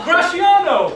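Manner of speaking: loud, high greeting, falling at the end; hailing back. Acted. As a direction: shout a friend's name in welcome. Hails friend back.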